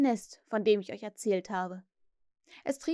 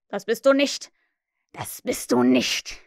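hoarse shout; 7 dB louder than usual. A narrator's reading. Hook denies the impostor's claim, hoarse shouting. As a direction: shout it twice, hoarse the second time. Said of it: "schrie Hook heiser".

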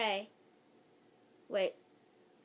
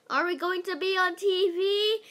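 higher, louder eager squeal; excited shout; 7 semitones higher, 5 dB louder than usual. Acted. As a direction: ask it bursting with excitement. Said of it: "some girl shouted".

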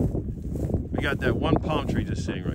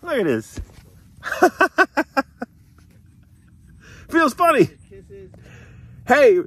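high, louder cooing to the animals; high pet talk; 12 semitones higher, 6 dB louder than usual. Real, not acted. Man cooing at llamas following him around.

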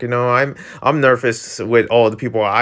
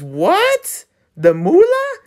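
much higher, excited gasp at the cash; surprised about money; slower than usual. Acted. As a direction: jump up high at the word moolah. Character hears about the pay.

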